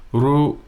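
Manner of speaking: ru held on a high level pitch. Shown as a demonstration. Teacher modelling the Thai high tone on ru. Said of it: "high tone".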